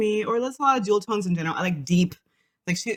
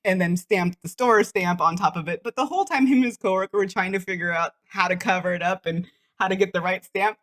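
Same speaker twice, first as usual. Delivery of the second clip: ordinary talk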